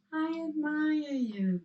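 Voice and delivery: a high echo of "I admire you" at normal level; the mountain echo answering. Retold or imitated. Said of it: "came back".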